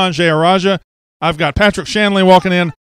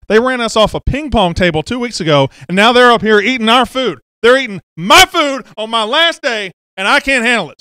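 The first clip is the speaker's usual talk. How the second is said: mock outrage; 6 semitones higher, 4 dB louder than usual. Mock outrage at crew eating.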